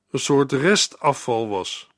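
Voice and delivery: ordinary delivery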